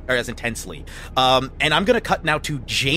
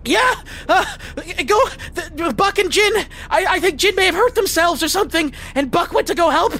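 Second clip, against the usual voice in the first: panicked high voice; 13 semitones higher than usual. Acted.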